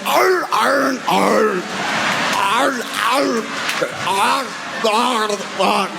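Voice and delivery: high shouted pained groans arr; imitating grape lady groans. Imitating grape lady after her fall, high shouted groaning. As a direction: mimic the grape lady's pained wails. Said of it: "she went".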